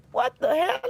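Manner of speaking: high loud alarmed what the hell; startled shout. Real, not acted. Alarmed by police.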